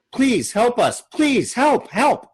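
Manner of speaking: high, urgent plea from a panicked mother; desperate woman begging. Acted. Woman to Muff, begging him to save her child, high and frantic. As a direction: beg for help as if your child is bleeding.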